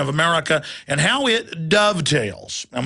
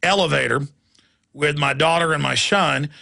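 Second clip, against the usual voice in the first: ordinary delivery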